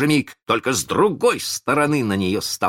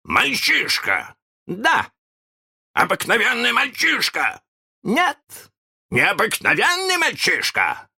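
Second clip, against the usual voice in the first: contempt; slower than usual. A narrator's reading. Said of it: "в голосе Питера зазвучало презрение".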